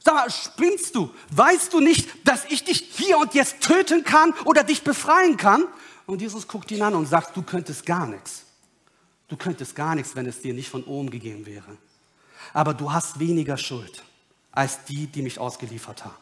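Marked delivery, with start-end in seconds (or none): 0.0-5.6 indignant, high-pitched outburst; acted rage. Pilate losing his temper at silent Jesus, angry and high. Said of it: "dann kriegt er so eine richtige Wut". 7.3-16.2 low and soft, unmoved; calm, quiet answer. Acted. Jesus looking at an angry Pilate, calm and soft.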